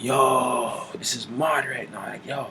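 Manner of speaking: hushed and high, awestruck. Retold or imitated. John in a trance, overwhelmed, hushed and high. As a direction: whisper it like you've seen something terrible. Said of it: "he was like".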